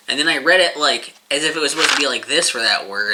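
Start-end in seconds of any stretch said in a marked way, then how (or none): none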